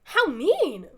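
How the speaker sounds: loud, high, swooping outcry; mock-hurt protest. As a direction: protest loudly at the meanness. Character protests a threat.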